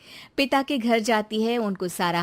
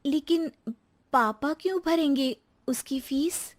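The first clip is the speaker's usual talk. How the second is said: put-on higher young wife's voice, puzzled; young daughter-in-law; 6 semitones higher than usual. Daughter-in-law questioning her mother-in-law's demand, higher and baffled. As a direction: ask it baffled and a bit higher.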